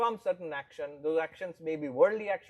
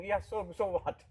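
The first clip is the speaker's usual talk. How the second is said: bewildered shrug; 3 semitones higher than usual. Retold or imitated. Mimicking bewildered listener.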